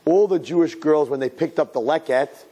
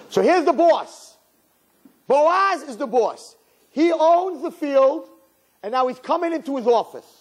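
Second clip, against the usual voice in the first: preaching with force; 10 semitones higher than usual. Acted. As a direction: lean into every word, building the scene. Preacher builds up the scene.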